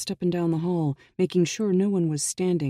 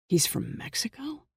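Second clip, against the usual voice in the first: hushed whispered question; lower voice; 7 semitones higher, 5 dB quieter than usual. A narrator's reading. Jack checks the hall and whispers his question to Ehud. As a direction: whisper it so no one overhears. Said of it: "he asked in a lower voice".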